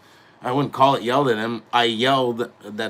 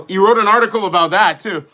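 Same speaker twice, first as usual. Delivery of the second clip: indignant outburst; 8 semitones higher, 8 dB louder than usual. Real, not acted. Ranting about the writer.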